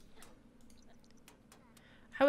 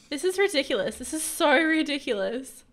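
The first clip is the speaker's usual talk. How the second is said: loud protest building higher; exasperated protest; 5 semitones higher, 7 dB louder than usual. Real, not acted. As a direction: protest louder and higher as it goes. Protesting her Sim's death.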